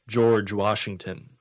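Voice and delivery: ordinary delivery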